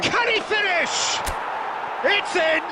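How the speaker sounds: rising to a high, shouted call of the goal; excited goal call. Real, not acted. Commentator calling the equaliser to viewers, rising excited shout. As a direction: build from anticipation to a full roar.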